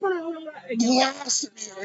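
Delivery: a slow stretched-out mock blast; mock rapid blast. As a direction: drag out blast me. Fast Spanish blasting him.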